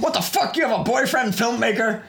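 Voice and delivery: high-pitched, hammed-up disbelief; incredulous, voice jumping way up; mock outrage. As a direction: squawk it in pretend shock. Acts out outraged reply to boast.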